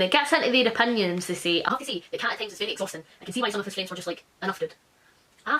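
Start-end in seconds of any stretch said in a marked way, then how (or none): 1.6-4.7 quick, quiet read-through of a review; hurried quiet review reading; fast reading aloud. Acted. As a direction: rattle through the quoted text softly.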